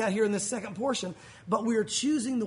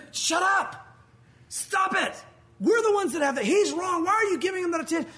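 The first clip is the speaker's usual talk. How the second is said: angry protest; 7 semitones higher than usual. Retold or imitated. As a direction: snap at them to stop. Pharisees angrily silencing the crowd.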